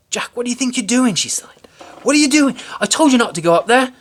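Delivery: high, loud scolding; furious mother. A narrator's reading. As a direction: tell him off furiously. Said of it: "His mom was furious at him".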